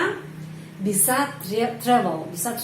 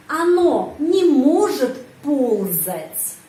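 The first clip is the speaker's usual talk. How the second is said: slow, louder, stress on не; slow model sentence; 2 semitones higher, 4 dB louder, much slower than usual. Shown as a demonstration. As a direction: read each word out slowly for the class.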